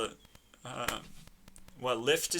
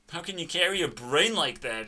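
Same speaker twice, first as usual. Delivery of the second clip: ordinary talk, nothing marked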